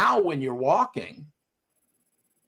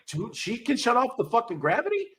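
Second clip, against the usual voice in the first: higher, bewildered dog voice; dog's thoughts; 7 semitones higher than usual. Retold or imitated. Trainer voicing the startled dog for his student, higher. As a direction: voice the dog's shock. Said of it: "he was like".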